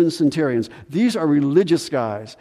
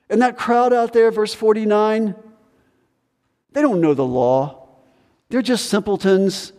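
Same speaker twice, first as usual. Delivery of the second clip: higher, scornful put-down of the crowd; contemptuous dismissal; 6 semitones higher than usual. Retold or imitated. Pharisees dismissing the crowd, scornful and higher. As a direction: sneer at the simple folk. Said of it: "you discredit people you disagree with".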